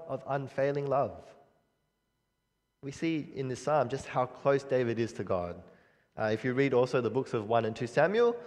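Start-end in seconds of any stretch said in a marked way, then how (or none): none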